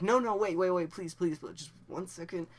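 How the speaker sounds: high, loud but toned-down begging; urgent pleading. Retold or imitated. Teen asking partner not to stop, high urgent plea. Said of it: "I wasn't like that, but I was like".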